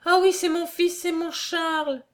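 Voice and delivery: high-pitched cries of recognition, easing at the end; overjoyed exclamation. A narrator's reading. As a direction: cry out as a mother who knows her son. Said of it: "s'écria-t-elle". (exclamations de joie, voix aiguë)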